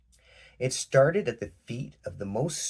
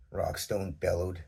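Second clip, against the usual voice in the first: plain reading voice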